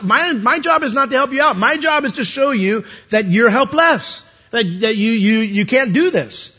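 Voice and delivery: a hint of a stern authority lecturing; voice of the law. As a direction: speak as the stern law itself. The law answers, personified.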